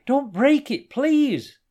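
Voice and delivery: high, loud pleading; desperate, high-pitched loud plea; panicked shout. A narrator's reading. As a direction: beg at a shout. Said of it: "shouted the man".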